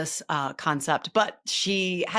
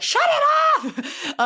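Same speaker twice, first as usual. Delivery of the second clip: much higher, louder mock shriek; mock panicked yell; 6 dB louder than usual. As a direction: yelp it like you can't stand your own voice. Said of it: "I'd be like".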